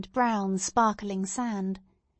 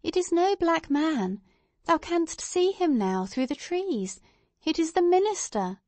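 mildly impatient mother, higher pitch; a touch of impatience, pitched up; 8 semitones higher than usual. A narrator's reading. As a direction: correct a child with light exasperation. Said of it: "said her mother impatiently".

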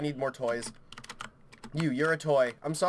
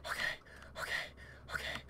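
barely audible whisper; whispered self-calming; 19 dB quieter, slower than usual. Real, not acted. Player calming self after a scare, whispered. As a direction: whisper to steady your nerves.

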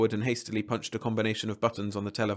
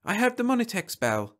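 high-pitched, eager woman's voice; 12 semitones higher than usual. A narrator's reading. Wife running in at the bell, eager. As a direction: blurt it high with anticipation. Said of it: "she said eagerly".

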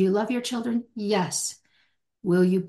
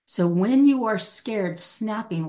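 ordinary talk

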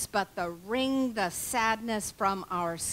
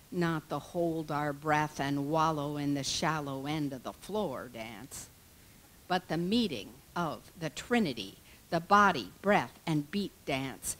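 ordinary delivery

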